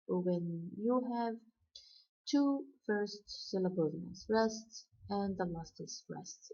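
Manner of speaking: ordinary delivery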